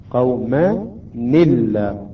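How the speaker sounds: قومًا الله slowly split, "qawma... ni-llah"; slow down and give the n of قومًا an "i" into الله; kasra on nun. Shown as a demonstration. Teacher showing the added kasra, slow split reading. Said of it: "نعطي كسرة إلى النون".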